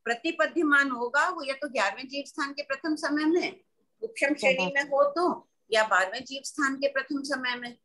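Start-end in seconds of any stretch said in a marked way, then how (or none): none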